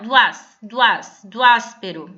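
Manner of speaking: teacher demonstrating the elision in "do áspero"; joined vowels. Shown as a demonstration. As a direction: repeat "do ás-" gliding the two vowels into one beat. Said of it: "eu vou juntar".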